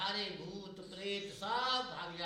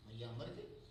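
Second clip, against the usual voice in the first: ordinary talk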